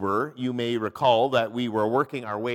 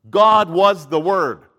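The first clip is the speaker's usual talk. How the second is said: loud, slow emphasis opening on "God"; stress on God; 5 semitones higher, 11 dB louder, slower than usual. Shown as a demonstration. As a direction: hit "God" first and hard, every word deliberate. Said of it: "the word God is in the emphatic".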